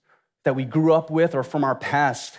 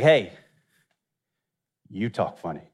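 low mock retort back at the kids; a hint of deep teasing comeback; playful retort; 9 semitones lower than usual. Teacher teasing the kids back in a low mock voice. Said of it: "I was like".

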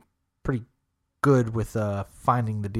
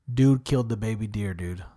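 ordinary delivery

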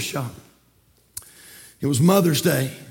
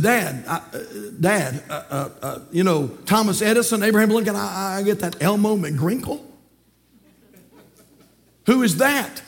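hesitant son. Acted. Son stops dad to ask about Elmo, puzzled and hesitant.

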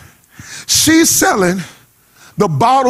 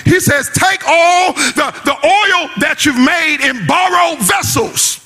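full-force church whoop; whooping sermon; 4 semitones higher than usual. Acted. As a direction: ride the organ and hoop it. Preaching climax over music.

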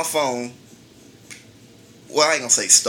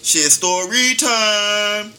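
drawn-out announcement; 6 semitones higher, 3 dB louder, much slower than usual. Acted. Announcing story time.

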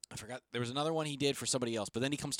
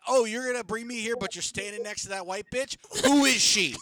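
angry shout; 8 semitones higher, 7 dB louder than usual. Retold or imitated.

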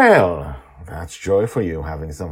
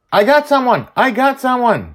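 put-on high, loud thrilled kid shouting; excited shout; 10 dB louder than usual. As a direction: yell the discovery. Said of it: "Charlie says".

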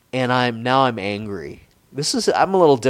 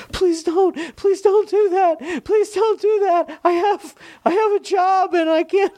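much higher, mock-pleading girl voice; imitating crying girl. Mimicking the girl begging him on the phone, high and pleading. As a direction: squeak out a mock tearful plea. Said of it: "she called me fucking crying".

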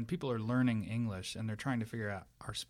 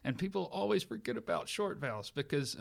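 normal talking voice

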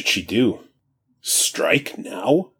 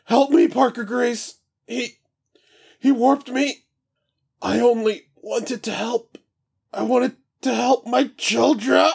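high, crying, begging voice; desperate plea. Acted. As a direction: sob out the plea. Dying demon begs Parker.

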